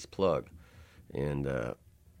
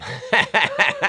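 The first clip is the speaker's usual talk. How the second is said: loud high burst of laughter; big laugh; 11 dB louder than usual. Real, not acted. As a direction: crack up laughing. Laughing at own story.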